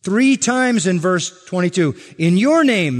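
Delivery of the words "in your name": "your" punched higher and louder, falling; stress on YOUR. Shown as a demonstration. Preacher showing the congregation the emphatic "your", stressed. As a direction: hit the word "your" in "In your name". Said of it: "emphasis on the word your".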